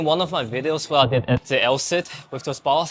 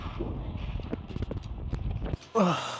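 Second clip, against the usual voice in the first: long unvoiced tired exhale; heavy exhausted sigh; 4 semitones higher, 11 dB quieter than usual. Real, not acted. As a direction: blow out a weary breath. Breath between exercise sets.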